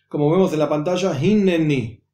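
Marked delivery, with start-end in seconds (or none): 1.2-2.0 "Hinneni" in three syllables, hin-ne-ni; sounded shva in Hinneni. Shown as a demonstration. Teacher reads the word on screen, sounded shva. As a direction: voice the middle e in "Hinneni". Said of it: "Si no sonase ese shva".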